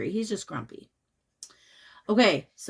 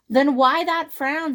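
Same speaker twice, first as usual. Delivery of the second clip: higher, louder question; lightly voiced snake; 6 semitones higher, 6 dB louder than usual. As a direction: ask it slyly. Snake asks.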